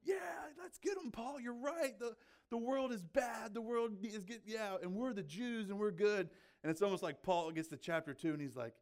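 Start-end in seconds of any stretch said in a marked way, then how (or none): 0.0-6.3 high-pitched imitation of eager supporters; mock cheering crowd. Religious listeners backing Paul, in a high mock cheer.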